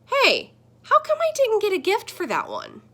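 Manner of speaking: high, loud whiny complaint; indignant kid. Acted. The kid protesting to the screen about a missing gift, high and loud. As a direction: protest it isn't fair.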